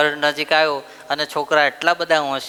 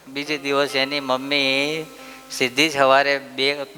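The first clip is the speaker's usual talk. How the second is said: ordinary talk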